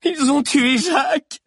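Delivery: very high, loud, slow shout; high-pitched slow wail; anguished cry. A narrator's reading. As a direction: cry it out in horror, stretching the words. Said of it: "cria Alix".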